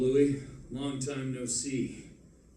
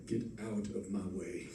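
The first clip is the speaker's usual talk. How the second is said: low menacing threat; 4 semitones lower, 8 dB quieter than usual. Acted. Repeated threat, lower and quieter.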